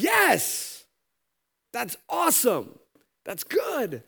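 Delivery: high, delighted yes; overjoyed servant. Acted. Forgiven servant thanks the master, high and elated. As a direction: burst out with relief and joy.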